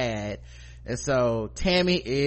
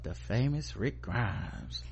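sultry; 3 semitones lower, 9 dB quieter, slower than usual. Retold or imitated. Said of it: "And she was like".

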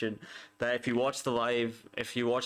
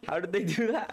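stunned disbelief; 10 semitones higher than usual. Retold or imitated. Fan reliving the late goal, stunned high cry. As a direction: shoot up high in shock. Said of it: "and I was like".

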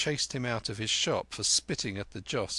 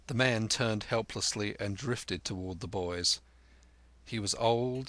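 plain reading voice

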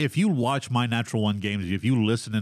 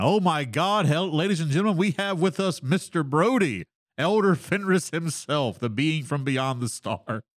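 booming hype-man announcement; announcer voice; 6 semitones higher than usual. Acted. Hyped guest introduction.

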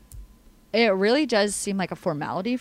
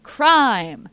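upbeat, louder announcer voice; game show host; 7 semitones higher, 6 dB louder than usual. Acted.

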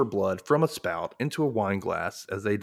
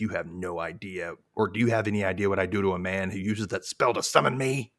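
Anyanka's scary demon voice; 2 semitones lower than usual. Retold or imitated. Podcast host retelling Anyanka's summoning, in a hushed scary demon voice. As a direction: breathe the threat like a vengeance demon. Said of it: "Yeah, her scary, scary demon voice".

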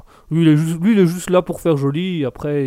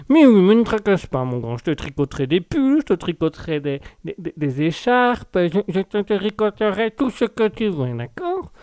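lightly voiced old granny, rising high at the end; doting granny; 4 semitones higher than usual. Granny promising her grandson knitted pullovers, doting old-woman voice. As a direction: reassure as a fussing little grandmother. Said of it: "À ma, à, à Mamie Duck".

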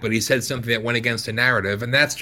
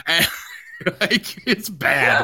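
giggling through the words; laughing at himself; 5 semitones higher, slower than usual. Real, not acted. Laughing about going overtime.

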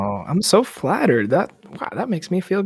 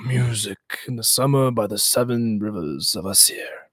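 whisper; 2 semitones lower, 6 dB quieter than usual. Acted. Whispered line inside the chant.

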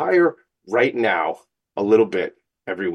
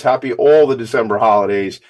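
normal talking voice

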